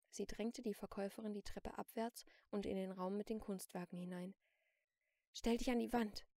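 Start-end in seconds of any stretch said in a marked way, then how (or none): 5.3-6.3 raised, firm command; firm order. A narrator's reading. Celine orders the shopkeeper to move, firm command. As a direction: order them firmly, short of a shout. First order, before she shouts.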